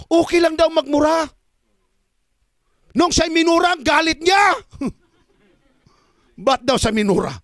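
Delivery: high, loud, shouted preaching; fiery mocking sermon about a cursing leader; preaching at full force. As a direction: hammer the point home to the flock. Preacher rails at rival church.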